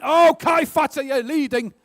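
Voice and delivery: excited jump in pitch and volume. Real, not acted. Quizmaster announces to a contestant that they're leading, excited. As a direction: burst out with real excitement. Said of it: "I'm excited about that".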